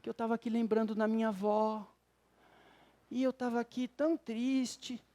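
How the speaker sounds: soft, quiet sighing wife; a hint of the sorrowful wife; imitating wife's sadness. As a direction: voice her gentle melancholy lightly. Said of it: "ela virou e falou assim".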